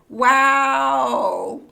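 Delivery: loud, high wow; impressed loud wow. Real, not acted. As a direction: marvel out loud. Impressed by description.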